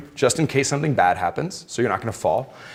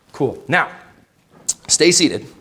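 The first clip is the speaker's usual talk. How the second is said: normal talking voice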